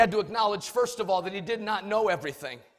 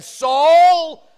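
voice from heaven; 6 semitones higher, 13 dB louder, much slower than usual. Acted. Voice from heaven calls Saul.

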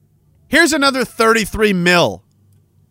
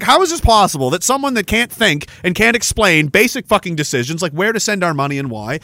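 ordinary talk

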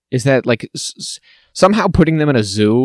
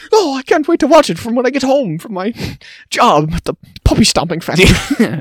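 much higher, gushing falsetto, laughing midway; mocking shallow viewer; 11 semitones higher, 4 dB louder than usual. Mocking a Capitol viewer eager to watch, in a high gush. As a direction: gush like a vapid fan.

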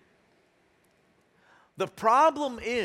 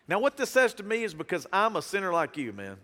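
usual speaking voice

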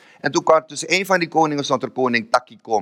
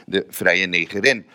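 slow, lower announcement of a title; title announced; 5 semitones lower, slower than usual. Real, not acted. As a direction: give the book title weight. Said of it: "voordragen uit het boek". (plechtig aangekondigde boektitel)

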